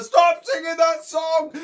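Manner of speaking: very loud, high, slow yell; furious bear shout. A narrator's reading. Bear ordering animals to stop the song, shouting madly. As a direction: bellow it, hammering out each word. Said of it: "He got madder and madder".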